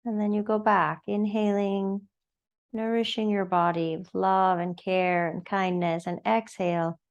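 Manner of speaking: unhurried, soothing yoga-class guidance; guided meditation. Acted. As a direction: guide them gently into noticing the breath. Leads breathing meditation.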